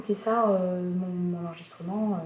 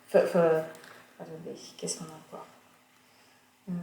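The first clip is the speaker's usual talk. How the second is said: ordinary delivery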